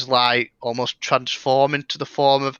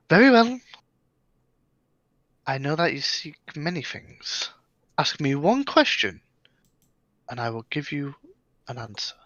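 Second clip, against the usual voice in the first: ghostly elf. Acted. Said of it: "as she's sort of smiling, she goes".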